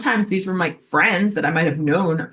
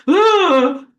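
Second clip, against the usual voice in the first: put-on high, loud disgusted ugh of a child; a kid squirming away, ugh; child's ugh; 11 semitones higher, 7 dB louder than usual. Child recoiling from a hug.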